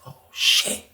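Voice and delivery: breathy shocked whisper under the breath; hushed startled curse; whispered oh shit. Retold or imitated. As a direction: whisper it like you just got caught. Shocked aside during tense encounter.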